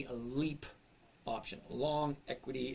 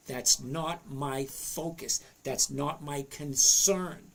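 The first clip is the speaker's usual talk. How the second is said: slow, deliberate, every word spelled out; over enunciating. Shown as a demonstration. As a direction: enunciate each word slowly. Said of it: "I'm gonna enunciate every single word".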